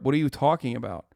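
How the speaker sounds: ordinary talk, nothing marked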